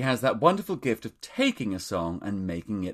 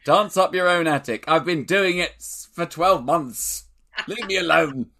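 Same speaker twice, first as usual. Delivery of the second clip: raised, loud, hammed-up exasperation; comic higher-pitched shouted complaint; mock indignant outburst; 6 semitones higher, 6 dB louder than usual. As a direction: protest loudly in mock outrage. Acted outburst riffing on attic joke.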